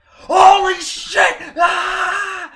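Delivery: high, loud, slow yell; ecstatic shout. Retold or imitated. Fan at the title reveal, ecstatic shout. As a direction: scream it out in pure fan joy. Nerdgasm moment reaction.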